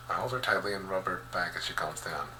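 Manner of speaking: plain reading voice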